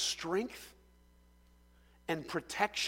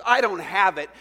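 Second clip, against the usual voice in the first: loud plea; 11 dB louder than usual. Acted. Servant begs the master.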